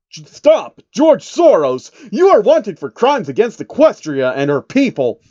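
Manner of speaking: high, loud commanding shout. Acted. Twilight Sparkle yelling after fleeing George, furious shout. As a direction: bellow the arrest order. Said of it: "Twilight Sparkle shouted".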